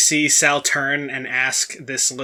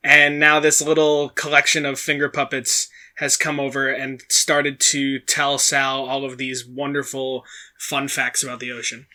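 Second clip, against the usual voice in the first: usual speaking voice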